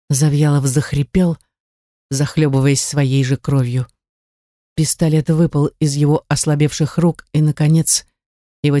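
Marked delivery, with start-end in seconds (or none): none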